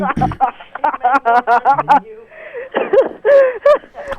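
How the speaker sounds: howling with laughter; big wheezing laugh; loud laughter. Real, not acted.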